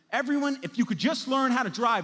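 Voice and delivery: loud, high, exasperated yell at other drivers; mock road rage, shouting at the traffic. Preacher acts out yelling at drivers in gridlock, shouted.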